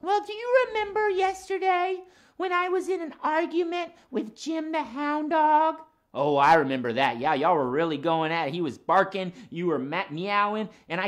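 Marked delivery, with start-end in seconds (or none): 0.0-5.8 higher-pitched puppet voice, friendly; lilting cat character voice; Max the cat. Acted. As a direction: play a chatty cat puppet for children.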